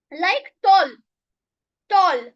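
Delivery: tall said as a single beat, one syllable. Shown as a demonstration. Teacher showing students tall as one beat. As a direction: say tall as one unbroken sound. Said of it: "you pronounce tall at a time".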